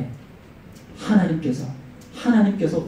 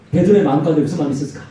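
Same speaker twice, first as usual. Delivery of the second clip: ordinary talk, nothing marked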